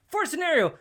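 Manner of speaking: ordinary talk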